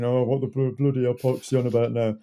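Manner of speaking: lightly put-on grumbling commenter; mock grumble of an annoyed fan; grumbly moaning person. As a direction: moan it like a fed-up viewer. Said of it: "me sounding like a grumbly person moaning".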